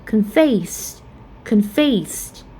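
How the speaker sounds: "confess" stressed on FESS; light "con", strong second syllable in "confess"; "confess" as con-FESS, twice. Shown as a demonstration. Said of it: "we stress at the second syllable".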